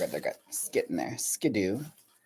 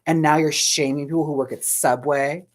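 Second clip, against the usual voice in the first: mock indignant; 4 semitones higher, 4 dB louder than usual. Mock scolding of John.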